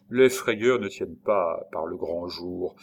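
usual speaking voice

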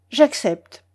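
supply the answer firmly, at normal pace; insistent prompt. Acted. Prompting him to say it. (ton pressant et décidé)

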